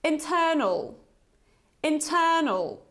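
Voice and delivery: "Internal" with a short schwa at the end, -nl; model word. Shown as a demonstration. Teacher reading the word for learners to repeat. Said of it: "let's read it together".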